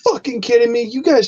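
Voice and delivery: high-pitched, exasperated disbelief; incredulous outrage. Retold or imitated. Voicing outraged reaction to film.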